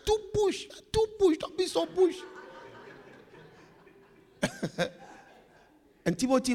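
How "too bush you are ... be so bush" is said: high mocking put-on voice; mocking taunt. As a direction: taunt them in a high, teasing voice. Mocking someone as backward.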